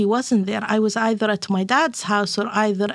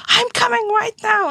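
much higher, frantic mock fury; retold rage; 12 semitones higher than usual. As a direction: replay the frantic mother's outburst. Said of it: "I was raging".

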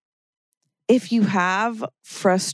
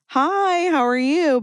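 high bright chirpy hello; chirpy greeting; 9 semitones higher, slower than usual. Retold or imitated. To a stranger who stepped on her toe, a high chirpy hello. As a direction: greet them like a delighted friend.

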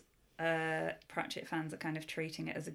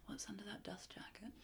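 breathy seductive whisper; sultry whisper; 3 semitones higher, 18 dB quieter than usual. Pretend. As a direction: purr it suggestively. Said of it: "that sounded pornographic".

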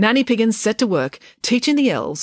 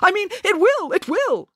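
hasty agreement. A narrator's reading. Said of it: "asked the centre manager".

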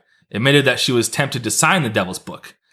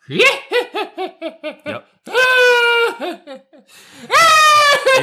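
laughing; 4 dB louder than usual. Real, not acted. Laughing after clearing throat.

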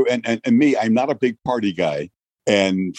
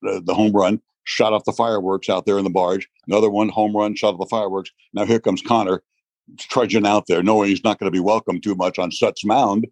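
usual speaking voice

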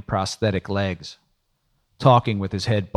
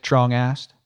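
ordinary delivery, nothing marked

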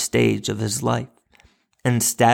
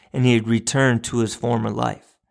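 ordinary delivery

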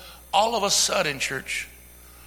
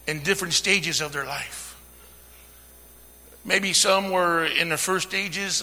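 normal talking voice

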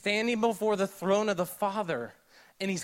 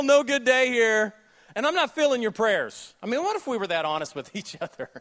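normal talking voice

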